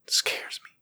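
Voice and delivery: frightened whisper. A narrator's reading. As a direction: barely breathe the words. Boy admits fear.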